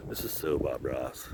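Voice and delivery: low, very soft murmur; quiet aside. Real, not acted. Vlogger to himself admiring the view, quiet aside. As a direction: say it under your breath, low.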